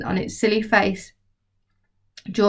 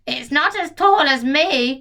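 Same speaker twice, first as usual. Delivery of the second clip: grandma sing-song; 6 semitones higher than usual. Acted. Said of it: "Grandma sang out".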